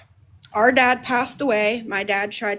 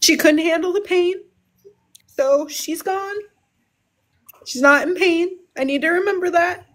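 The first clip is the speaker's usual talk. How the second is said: real emotion, higher and close to crying; grieving, higher voice; 5 semitones higher than usual. Real, not acted. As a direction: voice pitched up with held-back tears. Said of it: "excuse me while I get emotional".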